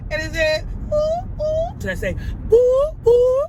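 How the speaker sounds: very high, mock-spooky hooting; playful ghost noises. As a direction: hoot like a pretend Halloween ghost.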